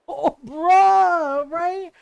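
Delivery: loud laughing groan of disbelief; half-laughing, exasperated bruh; laughing exasperation. Real, not acted. Reacting to the brother's sexism.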